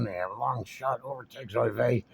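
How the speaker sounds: usual speaking voice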